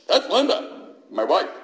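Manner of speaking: high, loud proud announcement of his wife; proud introduction. Retold or imitated. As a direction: show her off to the room.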